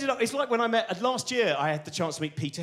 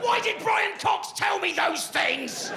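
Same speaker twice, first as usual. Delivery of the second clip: high, slow, hammed-up indignant wail; wounded imagined Higgs; 14 semitones higher, slower than usual. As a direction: cry out like a scandalised old physicist. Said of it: "where I'd just be sitting there going".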